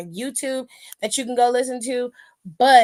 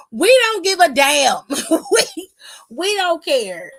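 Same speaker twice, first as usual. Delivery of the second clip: laughing dismissal; 5 semitones higher, 5 dB louder, slower than usual. Real, not acted. Laughing, dismissing wrestler's words.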